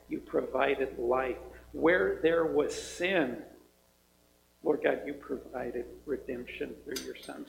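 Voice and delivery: low, soft, reverent prayer voice; earnest prayer. Acted. Pastor opening the service in prayer, low reverent prayer. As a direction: pray quietly and earnestly to the Lord.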